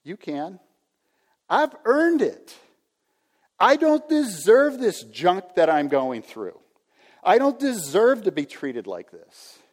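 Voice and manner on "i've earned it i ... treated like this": entitled whiner. Acted. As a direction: demand what you're owed like a spoiled grown-up. Pastor acting out entitled believer.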